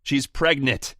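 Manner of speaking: high, loud blurted shout. Acted. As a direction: blurt it out to stop the hang-up. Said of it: "when he shouts".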